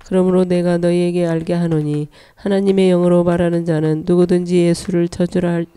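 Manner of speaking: ordinary talk, nothing marked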